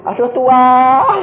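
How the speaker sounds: loud, high, stretched-out mock wail; exaggerated cry of dismay. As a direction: wail it slowly to heaven. Retold dismayed cry to God.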